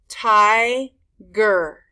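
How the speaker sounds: Tiger split loudly into ti, ger; two loud, separate beats in Tiger; syllable by syllable. Shown as a demonstration. Teacher showing two syllables, Tiger broken up. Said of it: "tell that's two syllables".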